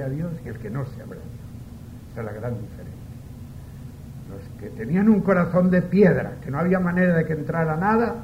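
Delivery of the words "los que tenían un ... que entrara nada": loud, high and urgent; forceful preaching. Real, not acted. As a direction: thunder it from the pulpit. Preacher thunders about hearts of stone.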